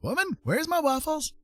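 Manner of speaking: high, hammed-up indignant demand; mock demanding husband. Man jokingly calls to woman for waffles, mock demanding. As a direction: pitch it way up like a pretend outraged husband.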